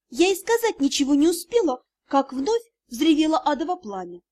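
ordinary delivery

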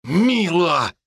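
pained growl of her name. A narrator's reading. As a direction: snap her name in pain. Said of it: "прорычал он".

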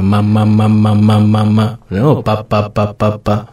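put-on baby babbling. Shown as a demonstration. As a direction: babble ma ma and pa pa like an infant. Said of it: "este laleo podría ser un poco eso".

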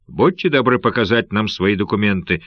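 ordinary delivery

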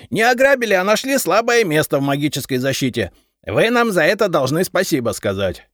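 запальчиво и громко, очень высоко; горячо оправдываться; крик. A narrator's reading. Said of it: "закричал Репей". (запальчивый крик)

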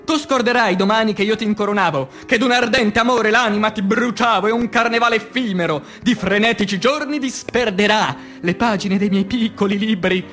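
ordinary talk, nothing marked

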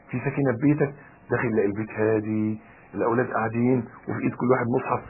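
ordinary talk, nothing marked

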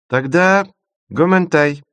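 высоко и громко, с заминкой; выкрутиться, слегка смутившись; немного смущённо. Acted. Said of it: "немного смутившись". (немного смущённо)

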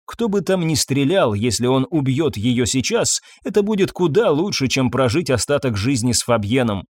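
plain reading voice

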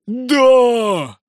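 high, drawn-out cheer; long rallying yell; howling crowd. A narrator's reading. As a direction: howl yes like an excited mob. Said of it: "завыла толпа".

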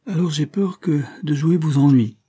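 lightly voiced worried little boy; anxious. A narrator's reading. Boy afraid his game will bore the count, mildly anxious. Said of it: "dit le petit garçon anxieusement".

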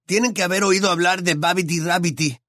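Ron's voice. A narrator's reading. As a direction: voice the gangly friend, amused.

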